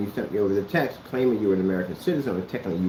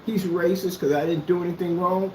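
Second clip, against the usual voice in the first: a higher, whiny imitation of his complaint; mock whining; 6 semitones higher than usual. Mocking the man who whines on YouTube, whiny voice. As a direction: mimic him crying victim online. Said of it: "cry and whine on YouTube and go".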